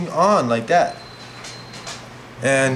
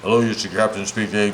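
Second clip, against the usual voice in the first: lower, mock pilot intercom voice; lightly voiced airline captain, lower; 6 semitones lower, faster than usual. Boy mimics pilot announcement to audience, low captain voice. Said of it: "they always sound the same".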